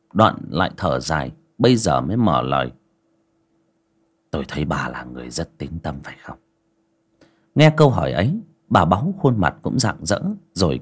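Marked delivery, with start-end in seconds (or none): 4.3-6.4 put-on low, soft old master's voice; low calm master. A narrator's reading. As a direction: speak quietly like a grave old sage. Ông thầy hỏi bà Báu.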